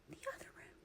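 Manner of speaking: barely audible whispered aside; whispering. Real, not acted. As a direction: drop to a whisper for emphasis. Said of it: "I'm whispering".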